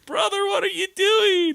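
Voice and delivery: put-on very high child's voice; high piping reproach; little brother scolding. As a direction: squeak it like a bossy little boy. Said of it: "Vic is fucking stepping in like".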